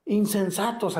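high, slow shouted rebuke; gritando. Acted. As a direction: cry it out like a prophet scolding. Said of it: "Y Jesús grita una palabra". (grito de reproche, agudo y lento)

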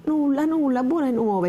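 put-on old servant calling out good news; chirpy old woman. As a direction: announce the food cheerily. Old woman answering the door.

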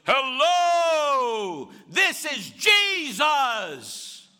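very slow, high, loud divine proclamation; booming fake Jesus. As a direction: boom it out like the voice of God. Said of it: "So he goes".

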